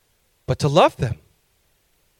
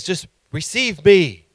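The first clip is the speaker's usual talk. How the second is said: lightly voiced appeal, higher and drawn out; Yeshua; 7 semitones higher, 4 dB louder than usual. Preacher voicing the Savior inviting seekers in, earnest appeal. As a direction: plead slowly, one phrase at a time. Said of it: "What he's saying is".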